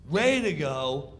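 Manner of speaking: ordinary talk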